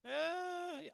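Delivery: high, loud drawn-out eh; drawn-out objection. Real, not acted. Cohost disputing that finding a voice takes long, drawn-out objection. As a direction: push back with a long high whine.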